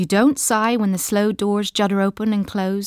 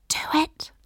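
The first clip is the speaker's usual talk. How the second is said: whispered; 8 semitones higher, 4 dB quieter than usual. A narrator's reading. Imagined voice of absent woman.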